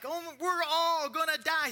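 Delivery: high, slow whiny wail; mock panic. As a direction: ham up the terrified fishermen. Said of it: "complaining like".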